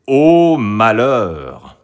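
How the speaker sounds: loud, very drawn-out cry of woe; dramatic lament. A narrator's reading. Storyteller laments Marie reaching the iron door, loud slow woe. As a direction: wail it slowly as a storyteller.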